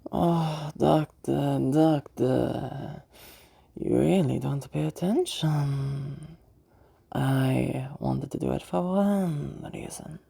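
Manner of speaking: slow feminine voice, stretching the important words; feminine villain voice. Shown as a demonstration. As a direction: toy with the listener in a dragged-out feminine voice. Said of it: "I'm gonna be using a feminine voice".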